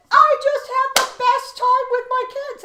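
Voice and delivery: high, loud, delighted gush; gushing teacher. Retold or imitated. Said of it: "And she said".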